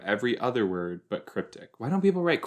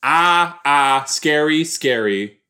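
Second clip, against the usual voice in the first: startled; 3 semitones higher, 7 dB louder, slower than usual. Real, not acted. Jump scare while watching.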